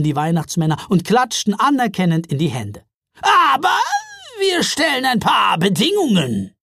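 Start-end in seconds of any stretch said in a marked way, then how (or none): 3.2-6.5 high, loud troll woman; a bold troll mother laying down terms. A narrator's reading. Troll mother Gaja speaks.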